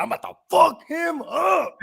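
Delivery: high, slow, hammed-up yell; mock shout. Fantasy rival trash-talking my team, mock shout. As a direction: bellow the trash talk. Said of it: "He's like".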